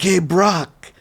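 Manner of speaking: retold call across a room. Retells calling out to the wrestler.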